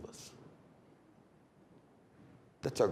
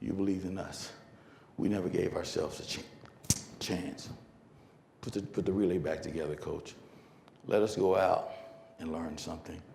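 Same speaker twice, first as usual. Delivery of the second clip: low, soft, halting confession; subdued apology; 10 semitones lower, 10 dB quieter than usual. Retold or imitated. Runners apologising to coach after crying, subdued low plea. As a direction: own up quietly, pausing between phrases. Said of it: "They cried".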